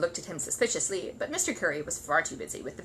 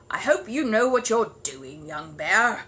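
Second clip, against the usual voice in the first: Mister Curry voice; 2 semitones higher, 7 dB louder, slower than usual. Acted.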